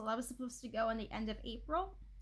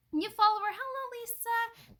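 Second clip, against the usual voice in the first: excited greeting; 10 semitones higher, 6 dB louder than usual. Real, not acted. Greeting new follower.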